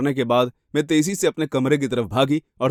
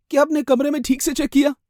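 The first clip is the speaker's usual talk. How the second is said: high, urgent query; anxious question; 11 semitones higher than usual. Acted. Worried victim asking police about the search, high and anxious. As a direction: press him, voice pitched up.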